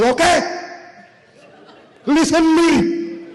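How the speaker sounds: high, slow Pakistani clerk taking charge; Pakistani accent. Acted. Pakistani clerk takes charge of the room, Pakistani accent. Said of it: "That means".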